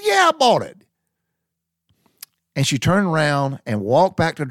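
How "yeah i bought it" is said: very high, loud, defiant confirmation; emphatic high yell. Retold or imitated. Confirming to wife he bought the car, high yell. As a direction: shout it up high.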